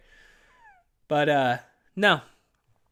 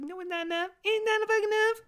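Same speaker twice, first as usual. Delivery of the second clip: high mock-whiny protest, pitched way up; high squeaky reply; 3 dB quieter than usual. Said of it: "It's like".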